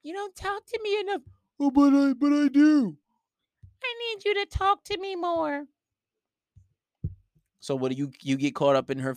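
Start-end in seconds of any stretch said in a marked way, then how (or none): 0.0-1.2 very high, soft whine; mock whiny girlfriend. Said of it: "girlfriend complains". 1.6-3.0 high, loud protest; mock defensive boyfriend. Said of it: "boyfriend protests". 3.8-5.7 mock whiny girlfriend. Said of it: "girlfriend demands".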